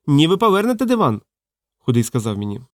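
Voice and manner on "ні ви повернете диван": high-pitched indignant wail; acted fat man's outcry; wailing protest. A narrator's reading. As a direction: protest frantically in a raised pitch. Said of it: "помчав за ним із лементом".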